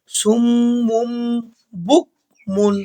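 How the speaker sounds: সুমুম chanted very slowly, doubled mim hummed through the nose; ghunna on mim. Shown as a demonstration. Teacher showing the iqlab, slow nasal chant. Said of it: "এইভাবে".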